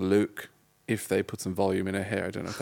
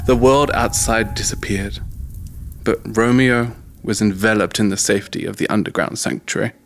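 dramatic story narration; 5 dB louder than usual. Acted. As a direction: read it like an epic movie trailer.

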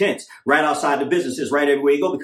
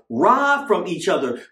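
ordinary talk

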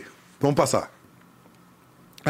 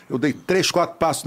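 normal talking voice

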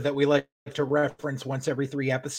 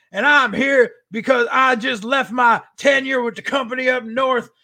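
mock wrestling promo; 6 semitones higher, 3 dB louder than usual. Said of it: "And he's like".

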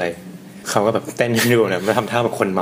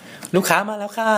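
much higher, slow sing-song staff call; imitating a clerk, higher and slow; staff announcing customers; 12 semitones higher, much slower than usual. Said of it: "ถ้าแปลเป็นไทยก็แบบว่า".

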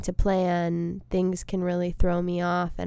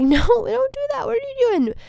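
high, pleading cry to spare a bug; much higher, urgent plea to stop; alarmed bug defender; 5 dB louder than usual. Retold or imitated. To someone killing a bug, high alarmed plea. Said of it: "I'll be like".

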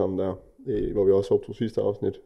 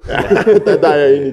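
higher, louder, through laughter; laughing agreement; 4 semitones higher, 8 dB louder than usual. Real, not acted. Griner over spoiler-snakken. (grinende, højt og lyst)

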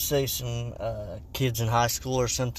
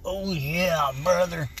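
higher, growly wrestler catchphrase; Hulk Hogan; 4 semitones higher, slower than usual. Acted. Host mimics wrestler's catchphrase, wrestler impression. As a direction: do a hammed-up wrestler's holler. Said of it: "who's that, Hulk Hogan".

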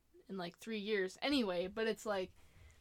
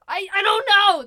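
much louder, higher burst of frustration; retold exasperation, loud and high; exasperated outburst; 8 semitones higher, 17 dB louder than usual. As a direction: throw up your hands, you have no clue. Exasperated retort about mom's description.

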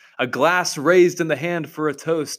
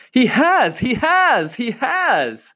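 squeaking; 11 semitones higher, slower than usual. Acted. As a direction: squeak out in panic like a baby squirrel. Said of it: "it squeaked".

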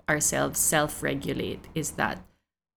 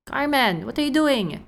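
mock raised voice; 7 semitones higher than usual. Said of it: "sort of raise his voice".

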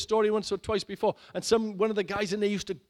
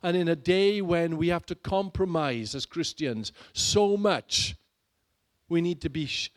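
ordinary talk, nothing marked

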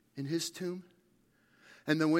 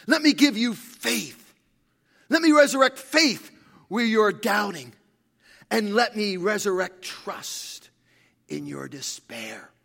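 raised, insistent pulpit appeal; full-force preaching; 4 semitones higher, 9 dB louder than usual. Acted. Preacher voicing Jesus's offer to doubters, full-force preaching. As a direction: press each promise on the congregation loudly.

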